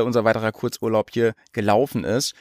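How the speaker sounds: ordinary delivery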